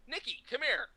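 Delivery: high-pitched retold summons; mock calling over. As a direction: beckon a friend in gleeful singling-out. Actor calls colleague over.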